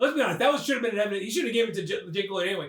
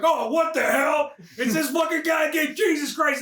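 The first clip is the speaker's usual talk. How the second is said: annoyed cop; 7 semitones higher than usual. Retold or imitated. Cop exasperated at Turbo Man.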